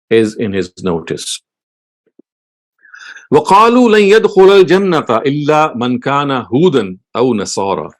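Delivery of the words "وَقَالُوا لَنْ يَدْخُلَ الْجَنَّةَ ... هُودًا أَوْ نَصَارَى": fast chanted Arabic verse; Quran recitation. Acted.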